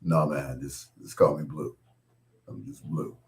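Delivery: low, soft imitation of a deep voice; Blue Lovett. Singer telling a nervous fan to use first name, low mock deep voice. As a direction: play a deep-voiced man, low and easy. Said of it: "That deep voice of his".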